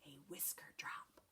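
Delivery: soft breathy hush for suspense; hushed narration. A narrator's reading. Narrator hushing young listeners, soft whisper. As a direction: drop to a near whisper.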